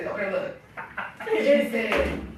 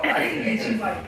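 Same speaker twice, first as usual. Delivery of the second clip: normal talking voice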